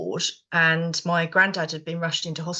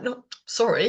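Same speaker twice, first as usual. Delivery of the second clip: ordinary delivery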